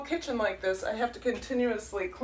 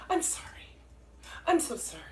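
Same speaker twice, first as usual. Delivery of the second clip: soft, breathy-free murmured sorry; Minnesota apology; 7 semitones higher, 4 dB quieter, faster than usual. Retold or imitated. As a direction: mumble the apology under your breath. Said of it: "People from Minnesota are always apolo".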